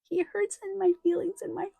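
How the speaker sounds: high-pitched, tearful toddler imitation; crying small child. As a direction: wail it like a hurt three-year-old. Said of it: "and he starts crying".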